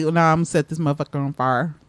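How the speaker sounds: country Texan talk. Retold or imitated. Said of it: "I'll be like".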